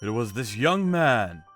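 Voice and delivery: plain reading voice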